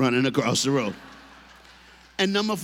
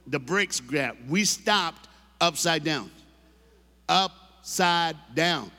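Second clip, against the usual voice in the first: slow, drawn-out words; dramatic slowdown; much slower than usual. Real, not acted. Telling the stuck coaster moment, slow and drawn out. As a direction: stretch each word for suspense.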